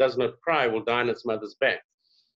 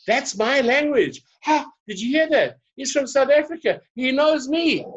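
high, loud, amazed exclamations; delighted crowd; 12 semitones higher, 4 dB louder than usual. Retold or imitated. Audience hearing their own language from a visitor, thrilled. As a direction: act out astonished listeners bursting with delight.

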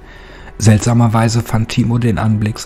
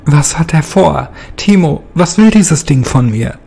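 higher and louder panicked questions; frightened cry; 7 semitones higher, 5 dB louder than usual. Acted. Captive Martin cries out in fear at Timo. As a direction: plead in rising panic.

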